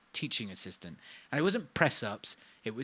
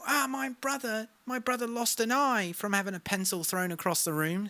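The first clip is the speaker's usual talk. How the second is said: a lightly voiced, higher, fast art teacher; breathless teacher telling her story in a higher voice; imitating art teacher; 8 semitones higher, faster than usual. Art teacher heckles from the crowd, lightly voiced higher. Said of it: "My art teacher will find a way to be in the crowd and go".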